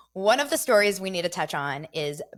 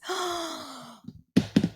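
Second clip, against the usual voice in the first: sharp high gasp of surprise; sudden real gasp; startled gasp; 11 semitones higher than usual. Real, not acted. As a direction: gasp as something startles you. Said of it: "Sorry, that was a remote".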